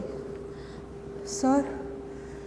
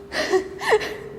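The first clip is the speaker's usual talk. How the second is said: high breathy tearful giggle; unhinged laugh; 9 semitones higher, 3 dB quieter than usual. Acted. Wife recalling her revenge, laughing through tears. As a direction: let a crazed laugh slip out. Deranged laugh during confession.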